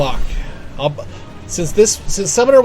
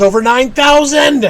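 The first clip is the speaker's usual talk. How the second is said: playful rising exclamation, louder; mock outburst; 8 semitones higher, 6 dB louder, slower than usual. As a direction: build to a hammed-up incredulous cry. Mock outrage quoting a threat number.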